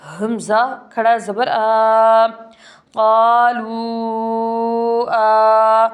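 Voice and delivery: loud, very slow drawn-out spelling; recitation spelling. Shown as a demonstration. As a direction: draw out each letter like recitation. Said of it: "spelling the verse slowly". (क़ाफ़ से क़ालू तक, बहुत धीमे और ज़ोर से हिज्जे)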